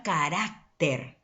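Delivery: "carácter" with a lean on rá, short break before ter; deliberate "carácter", weight on the middle; stress on middle syllable. Shown as a demonstration. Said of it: "desde el momento en que lo pronunciamos".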